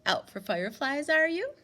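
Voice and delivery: man's light, lilting question rising up. A narrator's reading. Stranger greeting Winnie at the gate, lightly voiced. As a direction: ask lightly, like a friendly stranger. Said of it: "he said in a light voice".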